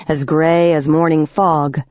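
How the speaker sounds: ordinary delivery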